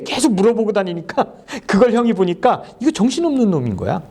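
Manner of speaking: ordinary talk, nothing marked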